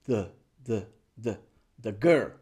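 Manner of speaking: put-on "the" as a low, short thuh, also in "the girl"; weak schwa. Shown as a demonstration. Teacher drills learners for practice, weak thuh. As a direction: keep every "the" weak. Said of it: "Es débil".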